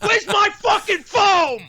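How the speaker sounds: high, slow furious yell about the beer; mock-rage scream at the bartender; furious shout. Acted angry patron.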